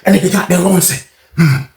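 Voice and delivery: usual speaking voice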